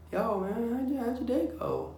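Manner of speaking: high, soft imitation of a chatty showermate; lightly mock the guy who won't stop chatting. Mocking a showermate who chats while everyone is naked.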